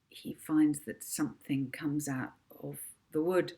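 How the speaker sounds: ordinary delivery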